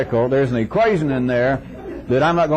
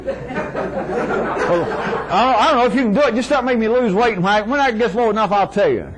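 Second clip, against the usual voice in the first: high, wavering then insistent, laughing through it; mock the client who wants a shortcut; hammed-up dieter; 7 semitones higher than usual. Dieter voiced in anecdote.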